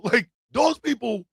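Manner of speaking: ordinary talk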